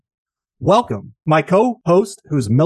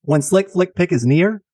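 usual speaking voice

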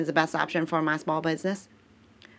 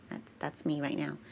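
muttered aside; 3 semitones lower, 12 dB quieter than usual. Real, not acted. Quiet aside to herself.

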